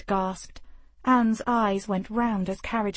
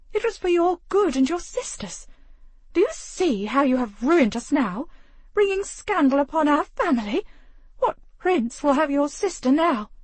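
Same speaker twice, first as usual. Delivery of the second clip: high, louder scolding; berating mother; 8 semitones higher, 5 dB louder than usual. Acted. The mother scolds Grace over the scandal, high and loud. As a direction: rebuke the daughter who ruined the family.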